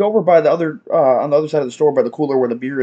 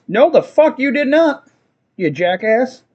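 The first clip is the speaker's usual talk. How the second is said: indignant retort; 7 semitones higher, slower than usual. Retold or imitated. Retort to customer changing his order.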